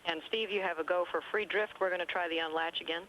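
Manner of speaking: crackly radio transmission; spoken over a space radio link; sci fi communicator. Real, not acted. Capcom calling shuttle by radio.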